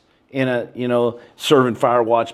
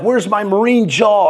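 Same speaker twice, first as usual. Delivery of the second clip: dismayed; 8 semitones higher, 4 dB louder than usual. Retold or imitated. Skinny recruit dismayed at himself.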